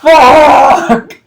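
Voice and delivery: high, loud single curse; frustrated yell. Retold or imitated. Gamer losing his game: high loud curse. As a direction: blurt out the frustration. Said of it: "I'm like".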